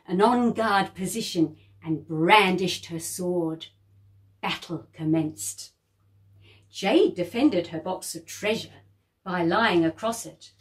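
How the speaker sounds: ordinary delivery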